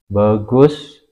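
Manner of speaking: ordinary talk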